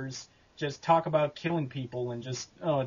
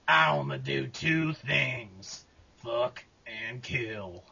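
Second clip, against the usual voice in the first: Bondo's southern drawl; 4 dB quieter, much slower than usual. Shown as a demonstration. Demo of the voice for cartoon killer Bondo, slow southern drawl. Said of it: "that southern drawl into it".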